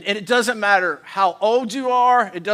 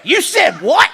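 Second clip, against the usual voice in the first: loud, high indignant wife with attitude; mad at husband; 11 semitones higher, 12 dB louder, slower than usual. Shown as a demonstration. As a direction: snap it as if fed up with your husband. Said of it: "like you're mad at your husband".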